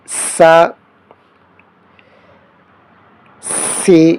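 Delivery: سا، سی، سو said with a clear hissing whistle; whistling s. Shown as a demonstration. Teacher showing students the whistling letter, hissed syllables. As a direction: let the s whistle sharply on every syllable. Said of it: "اس میں سیٹی کی آواز آنی چاہیے".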